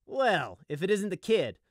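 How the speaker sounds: high, loud, drawled greeting; drawling griffin. A narrator's reading. Griffin greets the orphaned sheep, drawling. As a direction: drawl it like a swaggering bully. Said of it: "the griffin drawled".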